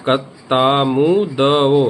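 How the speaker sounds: कतामुदओ very slow, every syllable stretched; slow mnemonic demo. Shown as a demonstration. Teacher drilling the memory trick for students, very slowly. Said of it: "इस तरह से जब हम इसको बोलेंगे".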